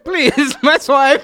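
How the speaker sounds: high, laughing mock accent; laughing Federico imitation. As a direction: giggle through a mock foreign accent. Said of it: "making fun of the way Federico talks".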